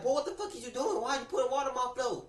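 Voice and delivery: high mock grandma yelling at grandson; scolding grandma. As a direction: play the outraged grandma. Grandma voiced scolding in story.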